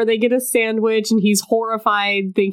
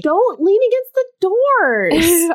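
subway announcement; 11 semitones higher, slower than usual. Retold or imitated.

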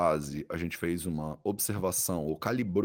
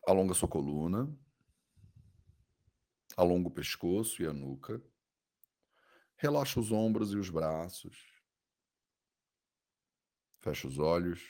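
soothing guided meditation. Acted. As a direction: guide the body scan gently, with long pauses.

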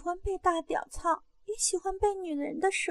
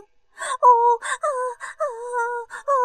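loud high moans; acted climax moans; 6 semitones higher, 7 dB louder, slower than usual. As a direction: cry out high, then keep moaning.

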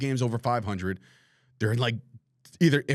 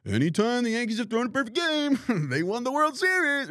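high-pitched know-it-all mock voice; statistic police; 11 semitones higher than usual. Host mocking the statistic police citing perfect games, squeaky know-it-all. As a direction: mimic a smug stats nerd, pitched way up. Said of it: "the statistic police out there like".

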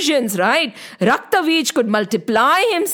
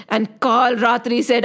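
ordinary delivery, nothing marked

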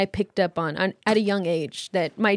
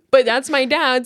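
ordinary delivery

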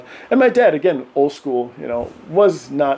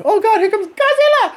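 high, mock-frightened child voice; kids' dread. Dad imitating his kids dreading his approach, high and mock-scared. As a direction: squeak it like a scared kid. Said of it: "You know".

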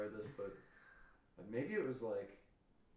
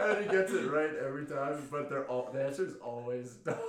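laughing; 3 semitones higher, 7 dB louder than usual. Real, not acted. Laughing while telling.

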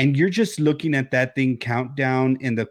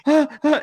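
mock scream; 3 dB louder than usual. Terrified at Haley appearing.

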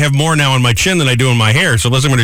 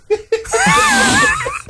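very high-pitched burst of laughter; high squealing laugh. Real, not acted. Host told he'd look less mean, squealing laugh. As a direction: crack up in a long squeal.

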